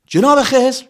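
high loud call across a cave. Acted. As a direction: call his name into the dark. Calling out, echo in cave.

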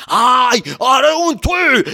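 high, loud shouted abuse; angry yelling. Acted. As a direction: yell furiously so all the neighbours hear. (فریاد بلند و عصبانی)

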